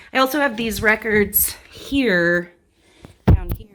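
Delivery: slightly winded after dancing; out of breath. Real, not acted. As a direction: talk while catching breath. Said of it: "I'm a little out of breath".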